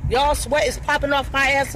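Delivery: ordinary talk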